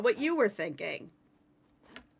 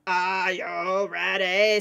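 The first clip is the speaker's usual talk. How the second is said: low, slow, drawn-out call; mock hype call; 4 semitones lower, slower than usual. Player hyping friends for the hunt, slow drawn-out call. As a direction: stretch every word like a showman.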